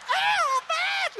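very high, drawn-out screech; piercing mock concert shriek; mom's frenzied fan scream. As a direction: imitate a hysterical fan screaming for her idol. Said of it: "And she's like".

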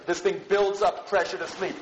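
normal talking voice

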